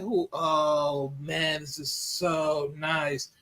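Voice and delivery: very slow, low and soft, drawn-out delight; savouring awe. Retold or imitated. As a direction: linger on every word in greedy wonder. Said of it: "he's like".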